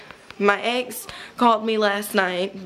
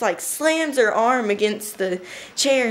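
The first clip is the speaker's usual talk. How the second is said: ordinary talk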